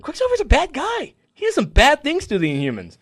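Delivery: very high, crying it's so cute; teary gushing. Real, not acted. Gushing to friends about father-daughter moment, very high and teary. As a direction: voice rising into tearful awe. Retelling touching comic scene.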